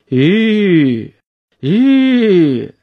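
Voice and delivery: long "whoa" to a horse, rising then falling; drawn-out horse-halting call. A narrator's reading. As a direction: call out a long, sustained "yuuu" to halt horses. Driver halts his horse.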